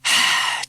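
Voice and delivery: long heavy sigh; deep sigh. A narrator's reading. Teacher sighs deeply over the bad news. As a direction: let out a weary sigh. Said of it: "He sighed very deeply".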